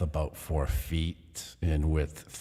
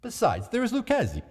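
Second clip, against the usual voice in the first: lightly raised voice of false concern; a hint of mock worry for his friend; Montresor, feigned concern; 9 semitones higher, faster than usual. A narrator's reading. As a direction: narrator voicing Montresor's sly concern.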